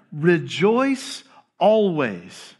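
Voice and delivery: very slow, spaced-out command; deliberate repetition. Acted. Paul answers the protesting church, slow deliberate insistence. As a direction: stretch out each word firmly.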